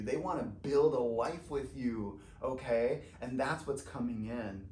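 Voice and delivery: normal talking voice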